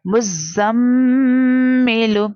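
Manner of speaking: मुज़्ज़म्मिलू with the doubled meem held nasally; with ghunna. Shown as a demonstration. Teacher demonstrates ghunna on the doubled meem, nasal hum. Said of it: "like this".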